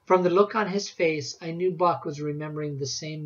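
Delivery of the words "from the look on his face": plain reading voice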